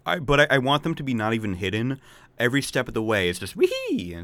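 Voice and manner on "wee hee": tiny creature squeal; high squeaky forest sprite cry; squeaky Korok voice. Retold or imitated.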